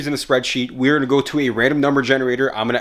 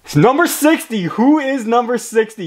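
hyped giveaway announcement; 7 semitones higher than usual. Real, not acted. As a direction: burst out with the winning number. Winner drawn on the wheel.

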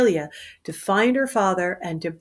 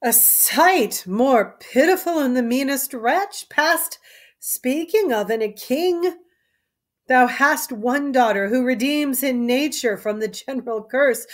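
slightly raised, measured lament; a hint of a mournful gentleman; 5 semitones higher, 4 dB louder than usual. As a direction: grieve for the fallen king.

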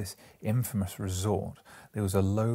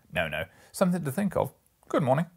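fast, louder, higher brush-off; brisk farewell; 6 semitones higher, 5 dB louder, faster than usual. Acted. Merchant cutting the talk short, brisk raised goodbye. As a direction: wave him off briskly. Businessman ending chat.

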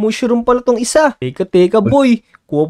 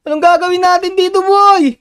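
frantic because a zombie is behind him; rising pitch of panic; panicked; 6 semitones higher than usual. Real, not acted.

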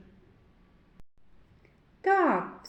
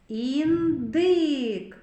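"Індик" slowly stretched as "Ін" and "дик"; teacher sounding out "Індик" in two long syllables; syllables drawn out; 4 dB louder than usual. Shown as a demonstration. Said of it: "зробимо звуковий аналіз слова".

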